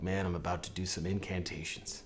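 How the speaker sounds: low, soft, unwilling grumble; reluctant mumble. Retold or imitated. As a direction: drag yourself into it half-awake. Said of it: "I'm like".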